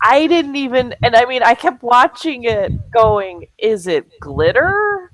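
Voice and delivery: high, loud, excited astonishment; giddy and loud, stretching the last words; amazed gushing. Real, not acted. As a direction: gush in disbelief, pitch climbing. Gushing over the athlete's oiled shine.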